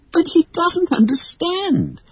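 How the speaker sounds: very high, slow tearful plea; about to cry over father's order. Acted. Wendy tearfully protests to her mother. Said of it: "said Wendy tearfully".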